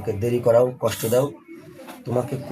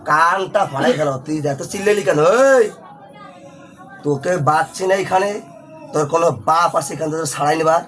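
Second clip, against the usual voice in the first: raised, menacing voice; threatening; 6 semitones higher than usual. Real, not acted. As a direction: threaten the spirit forcefully. Exorcist threatens the jinn. (উঁচু ধমকের স্বরে)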